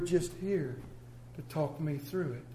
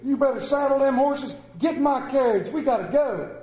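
loud high barked orders; hammed-up farmer calling orders; mock order; 8 semitones higher, 8 dB louder than usual. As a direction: hurry the boys out the door. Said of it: "and he goes".

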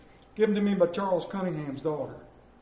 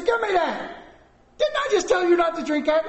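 very high, loud mock scolding; angrier parent; 10 semitones higher, 5 dB louder than usual. Dad to child drinking it a second time, hollering in exasperation. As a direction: lose patience and holler at the child.

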